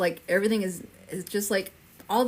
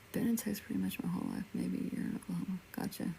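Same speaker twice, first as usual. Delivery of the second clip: whispered aside; 9 dB quieter, faster than usual. Real, not acted. Quiet aside, reading comments.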